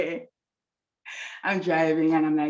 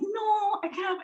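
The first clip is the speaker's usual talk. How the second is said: retold plea, much higher and softer at the end; high-pitched protest; 4 dB quieter, slower than usual. Protesting pastor's teasing, high pitch.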